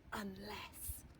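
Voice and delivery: hushed unvoiced whisper; conspiratorial whisper. A narrator's reading. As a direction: lean in and whisper the idea. Storyteller whispers scheming idea.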